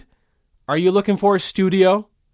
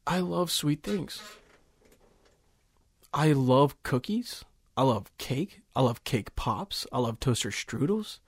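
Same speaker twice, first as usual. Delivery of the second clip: sponsor read; 3 semitones lower, 9 dB quieter, slower than usual. Acted.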